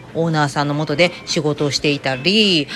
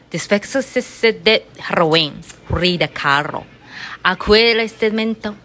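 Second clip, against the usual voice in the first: reading Spanish slowly, word by word; careful show-off Spanish reading for viewers; slow Spanish read-aloud; 3 semitones higher, much slower than usual. Shown as a demonstration. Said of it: "今からこれ読んでいきたいと思います".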